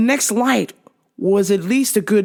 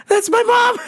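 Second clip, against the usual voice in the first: laughing. Real, not acted. Embarrassed apology to coworker.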